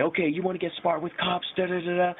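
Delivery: high, droning imitation of cop; mock cop. Cop cuffing smart-mouthed drunk, high mock scolding voice. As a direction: imitate a fed-up cop's threat. Cop retorting while putting cuffs on.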